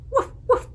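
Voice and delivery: high breathy woof woof like a puppy; mock dog barking, high; playful dog bark. Shown as a demonstration. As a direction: bark for the kids. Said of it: "What sound does a dog make".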